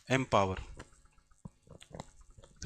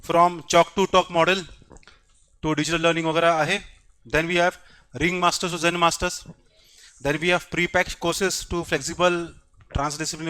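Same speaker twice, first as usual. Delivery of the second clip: slow, deliberate list of pointers; dictation; 4 dB louder, much slower than usual. Real, not acted. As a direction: read out each pointer slowly and firmly. Dictating three pointers to the class. (धीरे-धीरे, साफ़ लिखवाते हुए)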